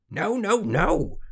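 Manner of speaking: high, loud, mounting tantrum; cross refusal. A narrator's reading. Little man stamping at wrong guess, furious. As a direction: stamp out each word in temper.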